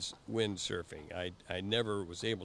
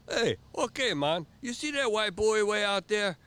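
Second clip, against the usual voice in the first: high, loud island voice pointing out the buoy; much higher, louder mock island guy; Jamaican instructor; 11 semitones higher, 8 dB louder than usual. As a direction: play the eager local instructor. Instructor sets the course.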